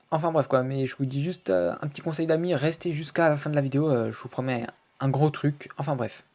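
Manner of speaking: usual speaking voice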